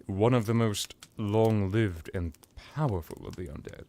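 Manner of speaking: ordinary talk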